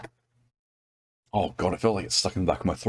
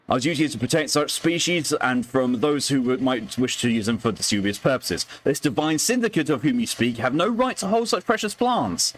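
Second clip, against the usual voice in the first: higher, formal letter reading; formal gentleman letter voice; 6 semitones higher than usual. Acted. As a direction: read it like a Victorian gentleman. Reads a letter in character.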